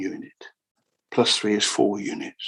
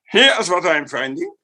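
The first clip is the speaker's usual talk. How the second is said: a hammed-up higher German accent; 6 semitones higher, 3 dB louder than usual. Lecturer shows how Kepler spoke, comic German accent. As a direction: play a comic German scientist. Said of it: "he spoke like this".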